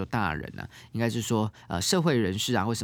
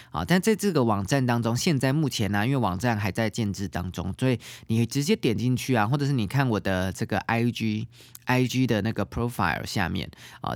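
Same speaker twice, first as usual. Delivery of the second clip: ordinary talk, nothing marked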